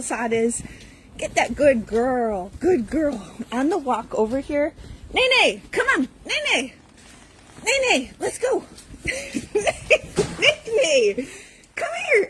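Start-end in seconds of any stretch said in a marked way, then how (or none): 5.1-12.3 very high sing-song call; high calling to the dog. Real, not acted. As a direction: call the dog over, pitched way up. Calling dog Nene over.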